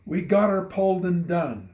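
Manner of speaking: loud, high, happy call across the field; shout of glee. Acted. Neighbor shouting gleefully to the bishop from his wagon. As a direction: shout the good news out gleefully. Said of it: "gave a shout of glee".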